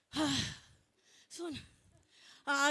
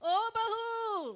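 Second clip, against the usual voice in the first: calling out; 6 semitones higher, much slower than usual. Acted. Mother-in-law calling for daughter-in-law.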